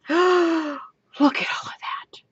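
gasp, then slow breathy whisper; awed whisper. Real, not acted. Crafter seeing all the silk, awed whisper. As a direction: whisper it slowly in wonder.

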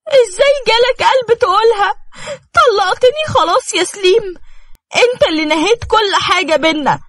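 high, crying and pleading hurt wife; tearful outburst. Acted. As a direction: sob it out at him in pain. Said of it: "بألم على وشه ودموعها مغرقة وشها".

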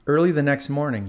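plain reading voice

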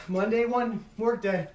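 normal talking voice

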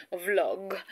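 ordinary talk

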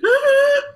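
very high, loud mock wailing laugh; imitated reaction outburst. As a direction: burst out in a piercing imitation laugh-cry. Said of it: "she's like".

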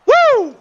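high, loud hype cry "woo". Real, not acted. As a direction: let out a big celebratory woo. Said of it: "hype cheer".

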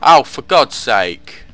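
slow exasperated groan. Real, not acted. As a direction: sigh it out in frustration. Needs a quarter to work.

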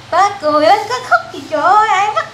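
high, fast laugh-through coaxing; laughing encouragement. Real, not acted. Mother laughing to coax crying son.

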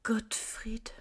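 soft slow breathy whisper; hushed and slow, just for him. Acted. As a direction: whisper it close to his ear. Intimate talk with imagined Gottfried.

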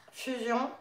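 ordinary talk

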